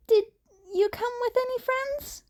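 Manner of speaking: put-on high, earnest teen voice; Izuku, shy and friendly. A narrator's reading. Izuku explains himself to the stranger, high and earnest. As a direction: keep it light and eager.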